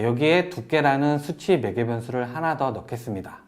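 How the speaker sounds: ordinary talk, nothing marked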